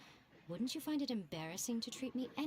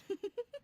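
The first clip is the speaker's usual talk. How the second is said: delighted laugh; 11 semitones higher than usual. Acted. Miko laughs at their faces.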